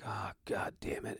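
hushed, slow whispered groan of dread; exasperated whisper to yourself; muttered dread. Retold or imitated. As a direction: whisper it under your breath, dragging it out. Muttering privately as kid approaches.

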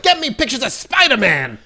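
shouted J. Jonah Jameson bark; barking newspaper editor. Retold or imitated. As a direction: bark the order like a gruff editor. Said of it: "He's like".

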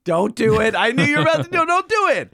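laughing plea. Real, not acted. Begging cohost not to do accent.

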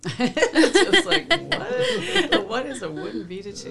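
high, laughing disbelief. Real, not acted. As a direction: laugh through the words. Laughing at the odd decor.

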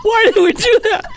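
high, loud, helpless laughter-strained voice; tearful laughing. Real, not acted. Co-hosts grossed out by the fruitcake, laughing to tears. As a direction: wail it through laughing tears. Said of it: "I have tears in my eyes".

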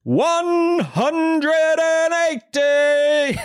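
high, hugely elongated score announcement; silly darts voice. Shown as a demonstration. Patient answering doctor's bad news, silly darts-referee voice. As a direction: call it like a darts referee, milking every syllable. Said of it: "Just stand up there in the middle".

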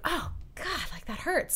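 high, soft pained whimper; mock a sharp sting of pain. Retelling cutting arm in store.

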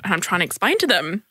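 ordinary talk

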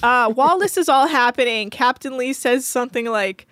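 normal talking voice

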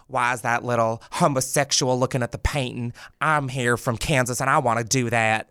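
hammed-up Southern accent; Southern bumpkin. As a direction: play a Southern yokel on holiday. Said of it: "like, a Southern bumpkin".

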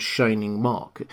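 ordinary delivery

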